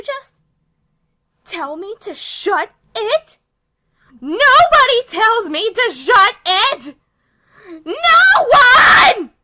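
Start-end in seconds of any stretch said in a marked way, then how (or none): 4.2-9.3 loud, rising furious yell; furious shout. Acted. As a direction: explode at the insult. Furious reaction to being told shut up.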